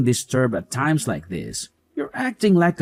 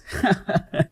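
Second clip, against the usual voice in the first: high amused chuckle at her expense; mocking laugh; 7 semitones higher than usual. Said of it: "How could you laugh about it like that?".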